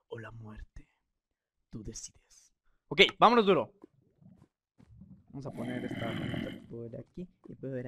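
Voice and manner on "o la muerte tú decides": hushed, menacing whisper; spooky whisper reading the sign; ominous whisper. Acted. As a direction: breathe it out like a dire warning. Reading the map's ominous choice.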